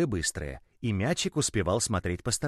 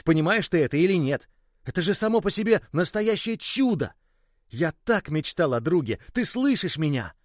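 higher and louder какой толк, building; excited shout; 7 semitones higher, 4 dB louder than usual. Acted. The boy cries out to the ball that it can talk, a miracle, loud and rising. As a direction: cry out in joyful disbelief. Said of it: "Мальчик вдруг закричал".

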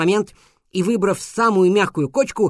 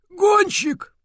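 teasing shout; 14 semitones higher than usual. Acted. Beaver teasing the rider.

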